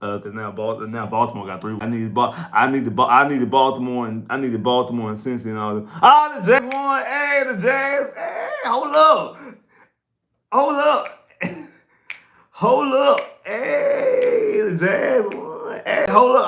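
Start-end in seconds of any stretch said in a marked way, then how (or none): 6.0-16.5 high, slow stunned cry of disbelief; drawn-out high hold up in surprise; shocked disbelief. Real, not acted. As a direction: react like you just heard shocking news. Reacting to Jags winning.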